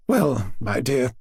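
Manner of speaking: slow, measured grandmotherly address; a touch of slow, ominous deliberation; deliberate opening. A narrator's reading. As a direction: begin slowly, weighing the boy up. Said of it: "she began after a long pause".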